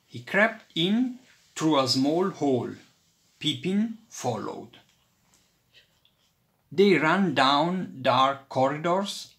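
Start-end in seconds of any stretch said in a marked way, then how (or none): none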